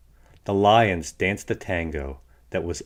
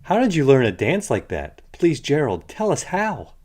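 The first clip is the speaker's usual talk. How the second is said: excited pleading; 6 semitones higher, 4 dB louder than usual. A narrator's reading. Animals begging Gerald.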